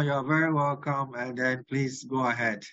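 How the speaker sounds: ordinary talk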